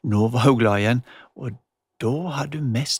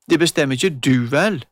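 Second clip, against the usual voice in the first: put-on hot-tempered girl, louder; heated; 6 dB louder than usual. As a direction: snap back in anger. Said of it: "hissig i stemmen".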